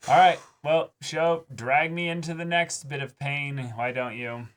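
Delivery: high, loud mock challenge to the show; mock-dramatic dare. Real, not acted. Fan daring the show to drag them into pain. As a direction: dare the show to hurt you. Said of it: "bracing for next painful episode".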